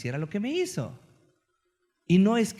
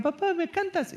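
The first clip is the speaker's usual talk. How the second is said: higher, mock childish plea; little girl asking; 11 semitones higher than usual. A little daughter asks her dad for a song, sweet childish plea. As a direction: beg like a small daughter.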